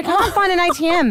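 high, flustered self-quote. Retold or imitated. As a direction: act out a mild panic for the retelling. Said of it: "I started getting really flustered".

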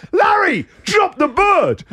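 high, slow shouted command. Retold or imitated. Owner shouting at his cat to drop the bird, high and loud. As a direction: yell it at the stubborn cat.

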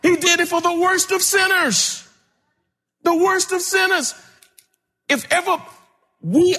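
high, ringing preacher cry; full-force preaching. Acted. Preacher telling the congregation Jesus did it for them, full-force preaching.

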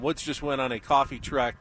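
ordinary delivery

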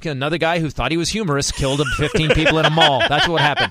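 laughing while talking. Real, not acted. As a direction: chuckle through the words. Laughing through a joke.

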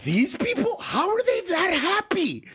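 high-pitched mock disbelief; incredulous. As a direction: ask it in baffled, high-voiced astonishment. Acts out baffled outsider's reaction.

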